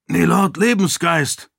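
urgent call. A narrator's reading. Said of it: "rief er eindringlich". (eindringlicher Ruf)

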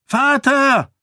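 high desperate shout across distance. A narrator's reading. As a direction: cry out for her father at full voice. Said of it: "schrie Sarene".

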